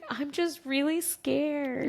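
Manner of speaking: slow, small scared voice; a touch of fright; mild mock fear. Mother telling her husband she's scared, mildly.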